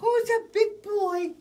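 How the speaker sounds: squeaky high coo to a pet. Real, not acted. Cooing at pet bird.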